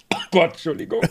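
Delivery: coughing fit after swallowing wrong; choking cough. Real, not acted. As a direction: choke and cough, then apologise. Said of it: "voll verschluckt beim Sprechen".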